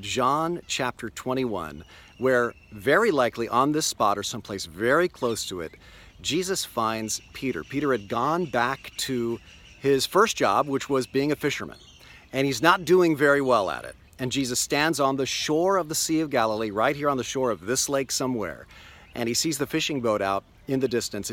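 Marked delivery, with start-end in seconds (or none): none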